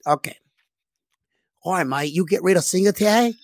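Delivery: put-on Australian accent. Player making the coach an offer, mock Australian accent. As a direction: play him as a mock Aussie bargaining. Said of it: "That's why I do the Australian".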